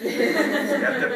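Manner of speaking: real laugh. Real, not acted. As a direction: burst out laughing on the word. Laughs with students.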